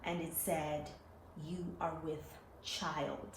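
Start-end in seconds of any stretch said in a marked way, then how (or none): 1.5-3.3 lightly voiced mysterious voice, low and soft; a hint of a solemn pronouncement, softly spaced out. Quoting the voice she heard.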